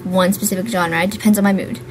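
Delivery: ordinary talk, nothing marked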